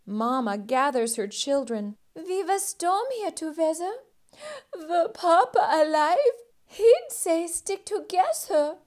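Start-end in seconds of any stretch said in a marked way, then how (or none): 2.1-8.8 higher voice, near crying; grieving mother mouse; tearful mother. Acted. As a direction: hold back tears. Widowed mother comforts children.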